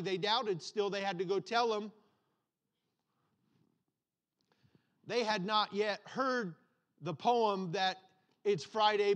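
building sermon cadence with punched words; fervent pastor preaching. Acted. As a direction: preach it to the congregation, rising. Preaches the sermon at full force.

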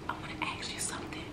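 put-on hushed, breathy boyfriend whisper, a little quick. Boyfriend whispering to girlfriend in bed, hushed whisper. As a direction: whisper it close like a secret. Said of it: "Stop whispering".

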